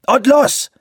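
high, loud shout of command. A narrator's reading. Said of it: "schrie Grey Wolf".

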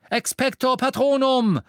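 high, loud spell cry; shouted spell. A narrator's reading. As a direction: cast it with full force. Harry casts the Patronus.

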